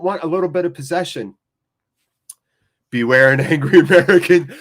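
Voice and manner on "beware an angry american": reads it out with a laugh; chuckling while reading chat. Real, not acted. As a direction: laugh through the line.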